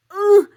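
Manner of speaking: loud, high ugh; acted groan of protest; exasperated groan. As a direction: let out a big exasperated ugh. Exasperated groan in retold argument.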